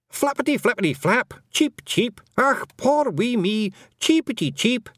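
high squeaky Scottish feagle cheeping; mock baby bird. Feagle luring the cat, hammed-up high cheeping. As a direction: squeak it out like a fake wounded chick.

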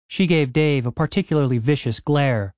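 plain reading voice

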